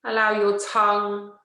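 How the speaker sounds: slow, measured yoga nidra voice with long pauses; guided relaxation. Acted. Teacher settling listeners into yoga nidra, slow guided relaxation. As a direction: guide them unhurriedly into rest, leaving space after each phrase.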